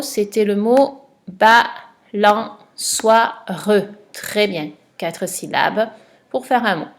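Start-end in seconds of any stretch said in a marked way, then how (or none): 1.3-3.5 slow, word drawn out for a pupil; slow dictation. Shown as a demonstration. Teacher reading the answer to a pupil, slowly. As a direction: spell it out slowly by ear. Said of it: "le premier mot".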